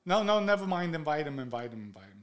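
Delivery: loud, high start dropping away quickly; retold insistence, fading as it repeats; insistent brush-off. Acting out insisting on the invite.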